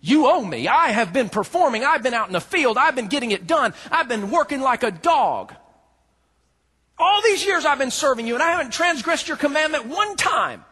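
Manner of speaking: high, loud, rising resentful rant; bitter outburst. Acted. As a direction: hurl the grievance at the father. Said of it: "what this son was saying to his father".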